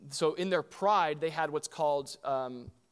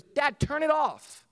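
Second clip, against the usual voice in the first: put-on high, loud, drawn-out little-girl voice; Evie; 12 semitones higher, 8 dB louder, slower than usual. Daughter telling dad to turn it off, high childlike protest. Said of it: "and Evie goes".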